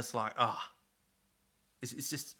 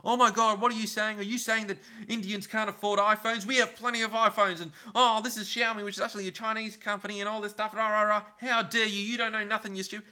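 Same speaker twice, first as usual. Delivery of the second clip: high-pitched, hammed-up indignant rant; mock outraged commenter; 8 semitones higher, 4 dB louder than usual. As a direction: squeal it like an offended commenter. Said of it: "there's a lot of vocal people saying".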